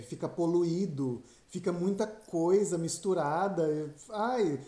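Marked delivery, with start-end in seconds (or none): none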